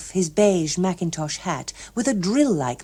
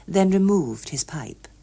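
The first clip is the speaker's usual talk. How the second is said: ordinary delivery, nothing marked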